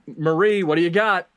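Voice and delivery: ordinary talk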